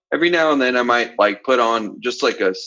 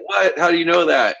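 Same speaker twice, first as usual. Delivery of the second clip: higher, mock-amazed question; surprised reaction; 7 semitones higher than usual. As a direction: play them caught off guard, delighted. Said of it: "and they'll be like".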